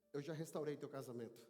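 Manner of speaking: low, measured promise; solemn assurance. Acted. God promises the worshipper restoration, solemn and reassuring. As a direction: pause after each reassurance, voice low.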